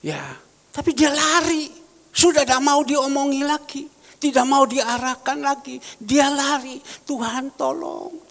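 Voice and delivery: fervent high pleading prayer to the Lord; impassioned prayer. Acted. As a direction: cry out to God for the boy who ran away. Prays aloud for the youth.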